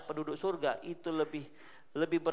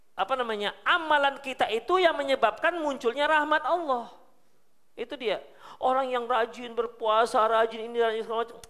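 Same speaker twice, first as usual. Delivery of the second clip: normal talking voice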